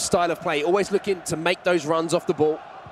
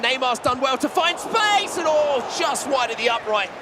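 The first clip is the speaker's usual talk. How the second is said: rising, higher call as the shot flies wide; excited near miss; 7 semitones higher than usual. Real, not acted. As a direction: build up then gasp at the miss. Near miss in live commentary.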